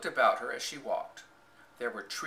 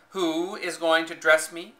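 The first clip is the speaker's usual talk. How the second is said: demanding; 4 semitones higher, 7 dB louder than usual. A narrator's reading. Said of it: "demanded Mary".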